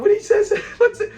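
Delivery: very high, loud squeal through laughter. Real, not acted. Host squealing while retelling Fred's question, excited. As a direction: pitch shooting up with glee.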